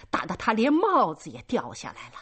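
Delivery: ordinary delivery, nothing marked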